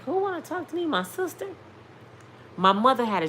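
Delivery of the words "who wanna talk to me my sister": puzzled disbelief. Retold or imitated. Daughter questioning the doctor on the phone, puzzled and high-pitched. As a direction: ask it high, as if it makes no sense.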